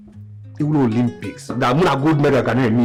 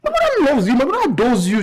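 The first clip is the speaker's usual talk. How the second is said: eager reassurance; 6 semitones higher, 4 dB louder than usual. Acted. Son reassuring girlfriend.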